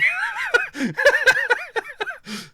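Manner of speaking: high-pitched loud cackling laugh; big high belly laugh; loud laughter. Real, not acted. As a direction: burst out laughing at your own joke. Laughs at own Luigi comparison.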